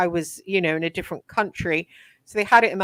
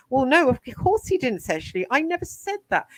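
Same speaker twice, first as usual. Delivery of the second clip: indignant; 7 semitones higher than usual. Real, not acted. Rebutting Alan's claim, indignant.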